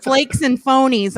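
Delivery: ordinary talk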